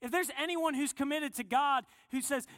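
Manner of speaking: usual speaking voice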